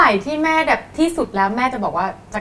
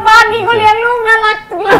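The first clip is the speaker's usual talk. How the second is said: higher and louder, laughing through the words; laughing delight; 11 semitones higher, 8 dB louder than usual. Real, not acted. Host laughing at the sweet family, higher and louder. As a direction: gush with laughter.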